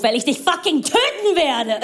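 rising, high-pitched threat; comically furious threat; mock rage. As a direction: build into an over-the-top outburst. Acts over-integrated German's threat.

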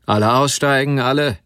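loud station announcement; conductor's call. Acted. Conductor clearing the train at Krebsdorf, loud call.

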